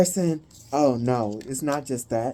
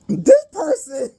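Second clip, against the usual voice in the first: high, slow, wobbling words; laughing through words; 9 semitones higher, 4 dB louder, slower than usual. Real, not acted. Reader starting the message again, laughing. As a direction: fight the giggles to get it out.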